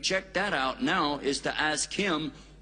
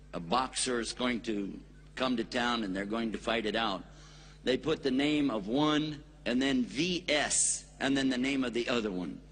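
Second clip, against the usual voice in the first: usual speaking voice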